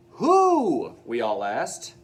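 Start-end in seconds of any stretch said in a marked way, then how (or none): 0.1-1.0 high, loud startled question; surprised cry. Acted. Class startled by the principal's answer, surprised cry. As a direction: blurt it out in surprise.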